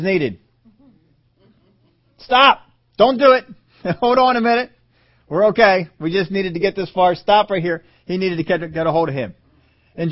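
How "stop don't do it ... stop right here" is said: high, loud, pressing halt; urgent command. Acted. God commanding Abraham to stop, high and loud. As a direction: stop him right now with a loud cry.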